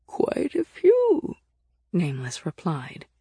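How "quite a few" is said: very slow, high, creaky ancient voice; old, scratchy voice. A narrator's reading. Nameless answers Nick about other priests, creaky and old. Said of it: "their voice so old and scratchy".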